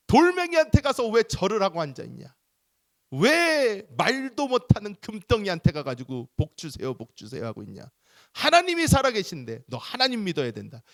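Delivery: put-on child scolding a friend about idols; preaching scold. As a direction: lecture the friend like a little preacher.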